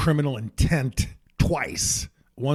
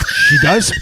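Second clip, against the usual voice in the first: squeaky, much higher and louder surprised question; surprised reply; 12 dB louder than usual. Retold or imitated. Actress answering the fan's compliment, high surprised mock voice. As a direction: imitate her delighted surprise.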